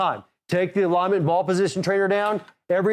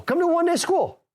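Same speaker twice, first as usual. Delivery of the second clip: eager urging; 11 semitones higher than usual. Real, not acted. Urging members to attend schools.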